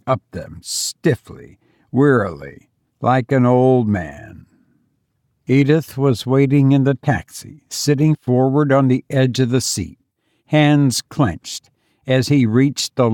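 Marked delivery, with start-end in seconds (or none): none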